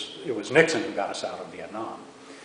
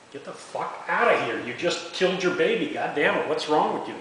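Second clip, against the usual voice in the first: retold angry yelling; 7 semitones higher than usual. Medic yelling at the mother over her dead baby, angry. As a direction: bark it at her like you're back on that helicopter.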